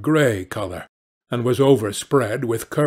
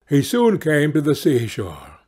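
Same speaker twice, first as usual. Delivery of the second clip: usual speaking voice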